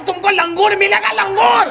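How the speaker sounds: very high mocking comic shout; angry husband. A narrator's reading. Husband angrily tells his wife she will get a monkey. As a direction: jeer monkey at her with hammed-up anger. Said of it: "تو غصے میں کہتا".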